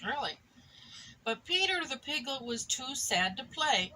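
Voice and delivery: plain reading voice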